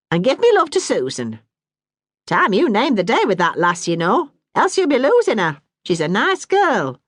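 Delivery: a hint of a brisk northern mum; Bessie, motherly Yorkshire woman. Bessie advises Dan.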